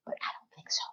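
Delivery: faint whispered doubt; whispered aside. Real, not acted. Podcaster taking back her concession to listeners, whispered aside. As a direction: drop to a whisper to take it back.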